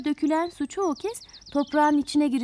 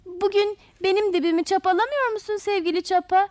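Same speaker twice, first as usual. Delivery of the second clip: high, sweet eager call; 8 semitones higher than usual. Acted. Flowers greeting their dear hoe from afar, calling out. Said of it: "diye bağırışarak karşılamışlar onları".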